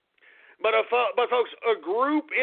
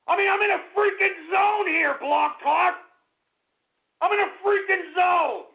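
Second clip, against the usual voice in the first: furious shouting; 8 semitones higher than usual. Acted. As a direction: rage that the flow is ruined. Raging about ruined flow.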